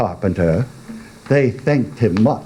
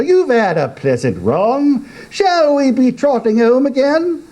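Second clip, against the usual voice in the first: higher, cheery carpenter; 12 semitones higher than usual. Acted. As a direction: call the oysters home brightly.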